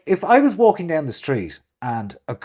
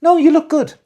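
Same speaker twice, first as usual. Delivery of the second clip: high, loud mock compliment; gushing compliment; 13 semitones higher, 8 dB louder than usual. Friend complimenting a man, high loud gush. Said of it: "men never get the".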